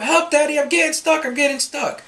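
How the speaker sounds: imitating a panicked little boy, high-pitched; crying for help. Dad imitates son begging for rescue, panicked. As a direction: call for Daddy in a high, frantic child voice. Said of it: "he starts to ask for help".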